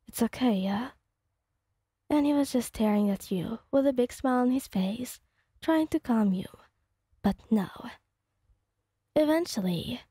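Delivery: ordinary delivery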